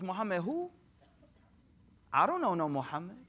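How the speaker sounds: guarded, puzzled denial with a questioning lift; feigned ignorance. Retold or imitated. Wary woman to stranger at her door, feigning ignorance. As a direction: play dumb, tossing it back as a question. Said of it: "'Cause we're low key right now".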